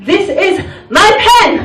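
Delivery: high, emphatic learner reciting a drill; mock-earnest schoolbook sentence; textbook English answer. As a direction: proudly recite it, pitched right up. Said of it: "I have to learn English like".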